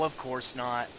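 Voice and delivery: ordinary delivery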